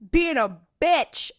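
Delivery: loud, high, drawn-out insult; high-pitched indignant yell; yelling at screen. Retold or imitated. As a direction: holler it slowly at the TV. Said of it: "I yelled at the screen".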